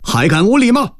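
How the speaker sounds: high, angry challenge; enraged demand. A narrator's reading. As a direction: demand it in a soaring pitch. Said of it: "孙延龄早已大怒". (大怒，高声喝问)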